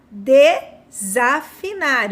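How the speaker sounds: "desafinar" spelled out slowly, des- clearly marked; slow teaching demo. Shown as a demonstration. Teacher to pupils, showing the new word very slowly. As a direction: draw out each syllable of "desafinar". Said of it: "a sílaba des no início".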